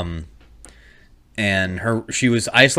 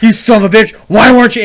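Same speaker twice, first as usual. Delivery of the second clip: high, loud mock tirade; imitated anger; 7 semitones higher, 8 dB louder than usual. Roommates cursing out the kid with covid, mock furious. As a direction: hurl it as an outraged roommate. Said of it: "they're getting mad at my oldest".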